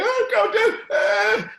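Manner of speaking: high-pitched, loud mock wail; mock crying. Worn-out athletes answering their coach during sprints, mock crying. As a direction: imitate athletes wailing through sprints. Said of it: "these guys are crying".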